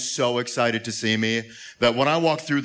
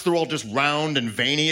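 ordinary talk, nothing marked

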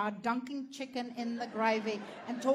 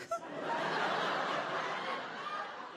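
real laugh; 8 dB quieter than usual. Real, not acted. Laughing after the ew.

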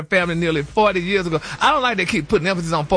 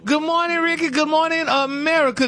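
high, bright sing-song hello; sing-song greeting; 8 semitones higher than usual. Real, not acted. Gossip host greeting Ricky and listeners, high sing-song. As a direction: greet the morning show warmly.

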